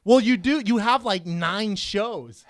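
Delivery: ordinary talk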